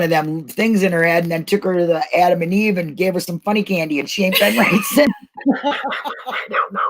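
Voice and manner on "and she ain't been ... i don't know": laughing through the words. Real, not acted. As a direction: giggle out the ending. Laughing at own joke.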